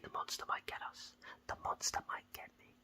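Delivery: hushed, fearful whisper of the monster; spooky whisper. Retold or imitated. Explaining her fear at night, in a spooky whisper. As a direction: whisper it like a ghost story.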